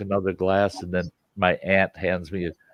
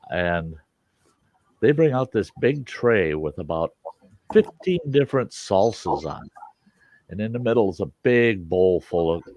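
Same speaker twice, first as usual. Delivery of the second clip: usual speaking voice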